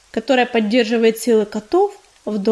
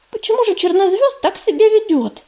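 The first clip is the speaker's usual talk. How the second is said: higher-pitched, puzzled question; surprised; 8 semitones higher than usual. A narrator's reading. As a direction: ask it in open surprise. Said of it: "удивлённо спросила Листвичка". (удивлённо, высоким голосом)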